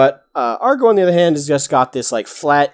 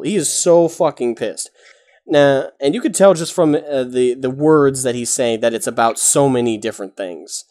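ordinary delivery

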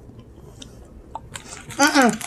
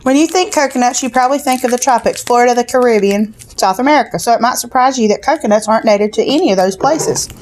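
booklet read-aloud; faster than usual. Acted. Reads the snack booklet aloud.

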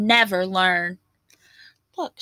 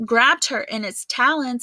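ordinary delivery

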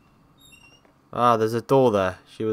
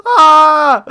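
terrified scream; 16 dB louder than usual. Real, not acted. Jump scare.

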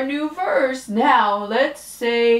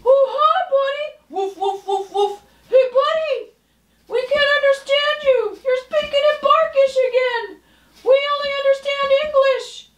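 high, fast, bubbly puppet voice; much higher chipper cartoon girl voice; 3 dB louder, much faster than usual. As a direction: chirp it like a perky little puppet. Second puppet talks to dog.

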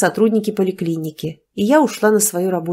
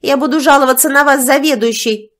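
higher, louder parting shout; 6 semitones higher, 5 dB louder than usual. A narrator's reading. Mistress yelling a threat while fleeing, shouting. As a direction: yell it back from the door. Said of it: "крикнула она напоследок".

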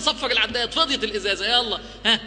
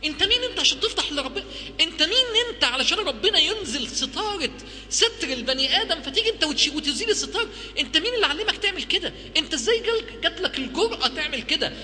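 higher, scolding disbelief; incredulous rebuke; 7 semitones higher than usual. Real, not acted. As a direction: challenge him, how dare you. Rebuking the listener. (استنكار وتوبيخ بصوت أعلى)

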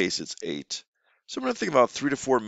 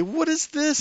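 much higher, playful; high teacher question; slower than usual. Shown as a demonstration. Teacher pointing at a picture for a child, high and bright. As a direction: ask it brightly. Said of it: "And then you say".

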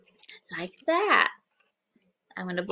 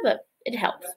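unvoiced whisper; whispered aside; 6 dB quieter than usual. Teacher tells children a secret, whispered aside. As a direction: let them in on it softly.

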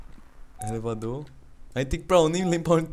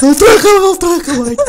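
frightened yell; 14 semitones higher, 10 dB louder than usual. Real, not acted. Begs not to lock door.

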